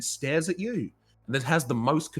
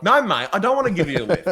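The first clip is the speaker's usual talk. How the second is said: laughing refusal; 7 semitones higher, 6 dB louder, faster than usual. Retold or imitated. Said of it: "I'm like".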